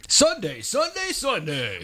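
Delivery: booming high announcer hype, rising; monster truck announcer. Acted. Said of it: "What would that be?".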